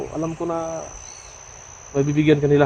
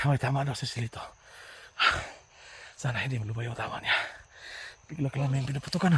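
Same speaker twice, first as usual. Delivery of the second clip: hushed; 2 semitones lower, 10 dB quieter than usual. Real, not acted. Hiding under fire.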